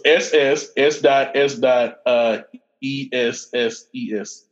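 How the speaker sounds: slow, letter by letter; slow spelling. Shown as a demonstration. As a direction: spell each letter out slowly. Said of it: "spelling the ship name slowly".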